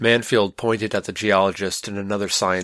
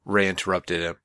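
usual speaking voice

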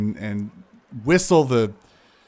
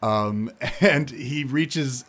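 usual speaking voice

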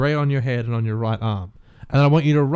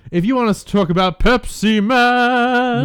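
Pepsi Man voice; 8 semitones higher, slower than usual. Acted. Announcer-style Pepsi Man bit.

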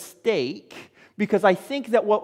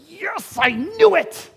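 excited; 11 semitones higher, 5 dB louder than usual. Retold or imitated. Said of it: "Peter is going".

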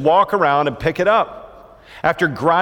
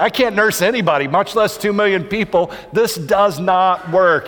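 higher, exasperated, slowing on the last words; fed-up Moses; 5 semitones higher than usual. Retold or imitated. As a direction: done with it all, pleading to God. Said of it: "Moses is like".

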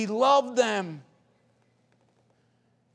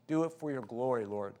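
ordinary talk, nothing marked

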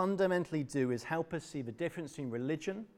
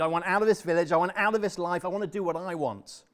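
screamed demand; 5 semitones higher, 7 dB louder than usual. Acted. Obed screaming his demand.

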